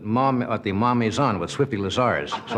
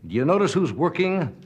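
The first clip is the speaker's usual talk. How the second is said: higher-pitched, building comic grievance; mock indignation; 7 semitones higher than usual. Host telling the audience he alone works holidays, mock-indignant. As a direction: play up the martyr, listing names in a rising roll.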